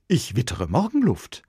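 lauter, höherer Ausruf; plötzlich gerufen. A narrator's reading. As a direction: bricht unvermittelt los. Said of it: "ruft er plötzlich". (Lorenzo ruft plötzlich mitten in die Probe)

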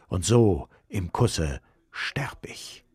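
langsam, tonlos gehaucht; geflüstert. A narrator's reading. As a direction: haucht den Sterbevers als Flüstern. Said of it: "flüstert dann". (Romeo flüstert hinter dem Mundschutz den Sterbevers)

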